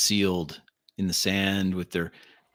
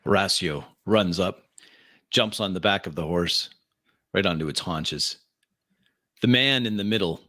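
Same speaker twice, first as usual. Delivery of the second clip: ordinary talk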